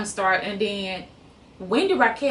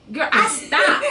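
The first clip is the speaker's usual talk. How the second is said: laughing; 8 semitones higher, 5 dB louder, slower than usual. Real, not acted. Stunned by co-star's voice.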